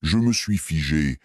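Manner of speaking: plain reading voice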